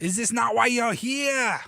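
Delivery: hammed-up Maximus accent; movie accent attempt. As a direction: throw the line out to the crowd like a general. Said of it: "that's not really the accent".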